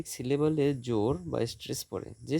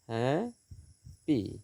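"Happy" very slowly as হ্যা, long gap, পি; split into syllables; much slower than usual. Shown as a demonstration. Teacher dividing syllables for students, "happy" slowly split in two. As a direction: draw out and separate both halves of "happy". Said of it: "syllable বিভাজন".

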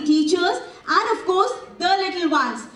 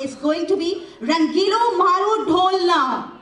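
ordinary talk, nothing marked

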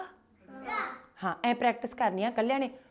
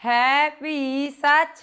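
slow recitation; 3 semitones higher, much slower than usual. Shown as a demonstration. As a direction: recite slowly for the class to echo. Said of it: "ਮੂਲ ਮੰਤਰ ਤੋਂ ਸ਼ੁਰੂ ਕਰਦੇ ਹਾਂ". (ਹੌਲੀ ਤੇ ਉੱਚੀ ਪਾਠ ਵਾਂਗ ਬੋਲੋ)

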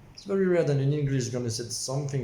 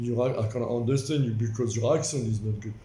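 mocking complainer; 2 semitones lower than usual. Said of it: "I always have to say something".

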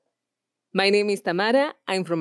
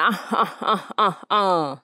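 evil laugh. Shown as a demonstration. Villain laughs.

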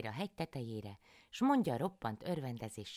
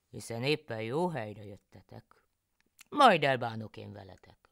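louder, scheming inner voice; louder sly husband plotting; husband János plotting; 10 dB louder than usual. A narrator's reading. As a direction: gloat over the robbers below. János thinks about robbers.

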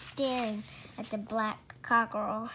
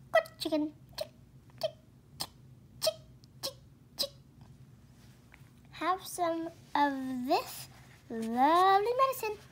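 high, sweet coaxing; 6 semitones higher, 3 dB louder than usual. Acted. George luring the chicken to the medicine, coaxing. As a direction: lure the bird gently.

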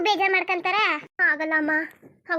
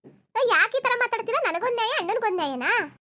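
angry protest; 5 semitones higher, 4 dB louder than usual. Acted. Daughter angrily confronts her mother.

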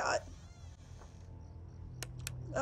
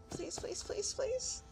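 desperate pleading; 6 semitones higher, 9 dB quieter, slower than usual. Real, not acted. Begging the game to survive.

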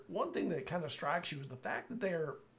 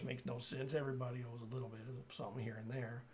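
usual speaking voice

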